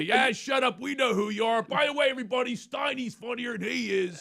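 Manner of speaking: high rowdy heckle; heckling shout. Retold or imitated. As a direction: shout him down from the front row. Drunk friends heckling from aisle.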